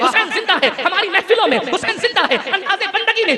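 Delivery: full-throated revivalist cry; climactic preaching. Real, not acted. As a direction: whip the crowd up to a climax. Refrain builds to its peak.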